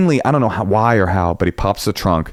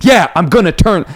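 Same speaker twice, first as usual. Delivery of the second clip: driver brushing it off; 8 semitones higher, 4 dB louder than usual. Retold or imitated. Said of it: "he's blowing it".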